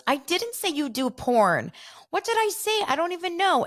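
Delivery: very high, mock-defensive denial; Whitney. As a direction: imitate a flustered castmate backpedaling. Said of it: "And Whitney goes".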